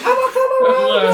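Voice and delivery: loud falsetto whoop; high-pitched mimicry. Riffing with co-hosts on high-pitched songs, high mock singing. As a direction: shoot way up high and loud, joking. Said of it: "That's all first gonna be high pitched".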